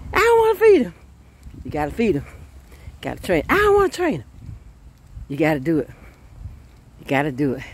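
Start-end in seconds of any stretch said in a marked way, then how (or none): 0.1-0.9 high, loud whine; whiny mother. Acted. Tired mother whines about feeding. 3.5-4.2 high whining protest; whiny mother. Acted. Mother whines about training.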